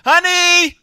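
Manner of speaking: loud, high holler for the wife; calling out. Retold or imitated. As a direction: yell across the house to your wife. Calls for wife during retold call.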